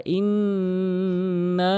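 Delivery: ইন্না with the nn held long through the nose; nasal ghunna. Shown as a demonstration. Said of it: "এভাবে গুন্না করবেন".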